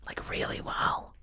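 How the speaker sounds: breathy emphatic whisper; whispered boast. Acted. Comic tells exes they're doing really well, whispered. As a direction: whisper it like a smug secret.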